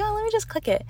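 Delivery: very high-pitched retold thought; light, high little inner voice; offhand impulse. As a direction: voice a passing whim way up high. Voices her own casual impulse.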